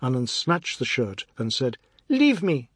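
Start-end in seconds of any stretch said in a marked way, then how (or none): 2.1-2.7 angry. Acted. Boy snatching shirt angrily.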